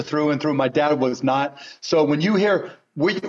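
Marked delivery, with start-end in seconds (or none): none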